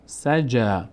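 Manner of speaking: سجا ending on a held aa; long alef sound. Shown as a demonstration. Teacher repeats after explaining the rule, long final vowel. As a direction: draw out the last vowel of سجا. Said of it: "صدای آ".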